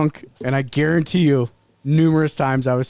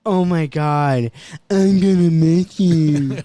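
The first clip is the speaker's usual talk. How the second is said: slow, drawn-out mocking copy; mocking wife; 4 dB louder, much slower than usual. Wife mocked back by the parrot, slow and drawn out. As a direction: stretch it out to mock her. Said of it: "She's like".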